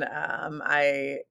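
normal talking voice

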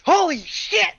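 high, startled exclamation; shaken Kinku. Acted. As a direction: yelp it out in shock. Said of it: "Even Kinku, like, shakes".

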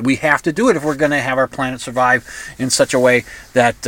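ordinary delivery

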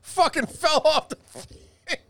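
giggling, barely able to speak; laughing through the words. Real, not acted. Microphone fell off.

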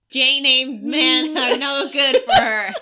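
slow loud laughing verdict; laughing declaration. Real, not acted. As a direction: proclaim it through laughter.

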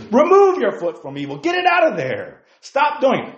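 high, loud pulpit exhortation; forceful preaching. Real, not acted. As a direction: preach it hard, pushing them to act. Preacher urging to quit sin.

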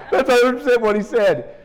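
high burst of laughter, still laughing through the words. Real, not acted. Preacher recalling his old teacher, laughing loudly. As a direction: crack up remembering it.

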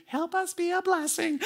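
put-on very high, small child's prayer voice; little child praying. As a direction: imitate his little girl saying her bedtime prayer. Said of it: "I've got my five-year-old and my three-year-old".

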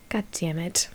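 breathy whispered god damn it under her breath; whispered curse. Real, not acted. Reading a cringey Tinder message, whispered curse. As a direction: whisper it to yourself, exasperated. Muttered aside after his reply.

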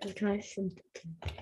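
very soft voiceless whisper; whispered aside. Real, not acted. Quiet aside while sending video.

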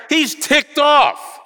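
high, loud, drawn-out exclamation; ticked off. Acted. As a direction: proclaim the anger slowly at a shout. Reveals that Jesus is angry.

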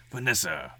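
a hint of a heavy crime boss; lightly voiced Kingpin calling her name. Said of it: "Kingpin's voice".

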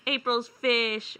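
slow, half-hearted prank shout; mock yell. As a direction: imitate a prankster's yell, stretching the words. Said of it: "they'd yell".

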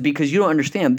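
eager, emphatic disbelief that they don't get it; excited salesman. Retold or imitated. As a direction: tell them it's a huge opportunity. Excited salesman explains the huge opportunity.

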